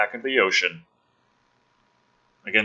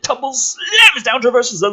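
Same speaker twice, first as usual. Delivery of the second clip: big loud high punch on the action; booming high action-trailer read; dramatic; 12 semitones higher, 8 dB louder than usual. Acted. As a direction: slam the words down like the impact. Dramatic reading of action scene.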